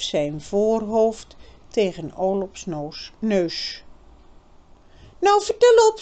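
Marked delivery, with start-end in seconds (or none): 5.2-6.0 higher, louder impatient demand; girl's voice, impatient. A narrator's reading. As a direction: push him to spill it. Birgit demands.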